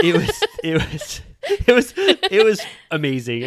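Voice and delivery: words breaking into laughter; real laughter while talking; laughing. Real, not acted. Host laughing about Vicky's outburst. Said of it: "laughing through it".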